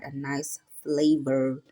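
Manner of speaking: slow, drawn out nice flavor; savoring. Real, not acted. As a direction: linger over it like tasting. Savouring the flavor.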